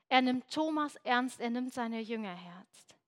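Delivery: normal talking voice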